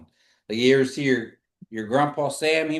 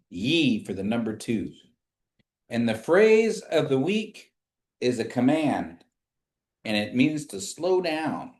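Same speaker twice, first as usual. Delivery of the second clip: normal talking voice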